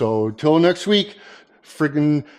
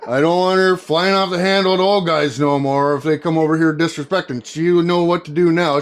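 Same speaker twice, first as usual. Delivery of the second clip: ordinary delivery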